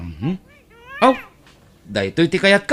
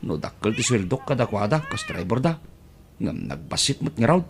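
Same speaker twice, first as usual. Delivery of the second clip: usual speaking voice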